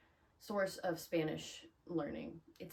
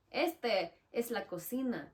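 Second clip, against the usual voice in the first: slow, higher Spanish announcement; Spanish speech; 4 semitones higher, 4 dB louder, slower than usual. Shown as a demonstration. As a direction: slowly announce it in demonstrative Spanish. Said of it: "You're gonna speak Spanish".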